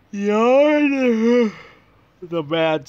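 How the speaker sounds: loud, high hammed-up exclamation; dramatic shout. Announcing the mad scientist villain, a hammed-up loud shout. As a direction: belt it like a big reveal.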